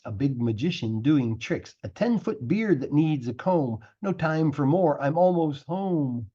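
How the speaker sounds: ordinary delivery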